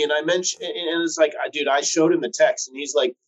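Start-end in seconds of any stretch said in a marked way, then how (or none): none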